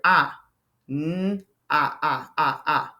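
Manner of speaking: ah repeated in short beats; teacher sounding out short O. Shown as a demonstration. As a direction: chop out ah, ah. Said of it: "short vowel sound".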